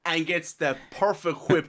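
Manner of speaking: ordinary talk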